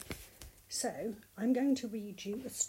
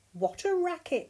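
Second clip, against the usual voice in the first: mild storybook yell, pitched higher; 7 semitones higher, 4 dB louder than usual. A narrator's reading. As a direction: yell lightly as Mole, voice raised in pitch. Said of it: "yelled Mole".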